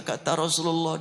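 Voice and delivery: ordinary talk, nothing marked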